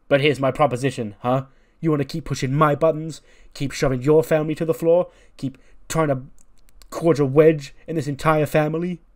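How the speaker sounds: heated challenge. Acted. Father challenges son for dividing the family, heated. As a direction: lay down the challenge, punching the key words.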